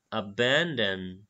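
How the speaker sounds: "Abandon" stretched out very slowly, each syllable drawn long; very slow pronunciation drill; slow motion. Shown as a demonstration. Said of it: "এটাকে আমি slow motion on করছি এবার".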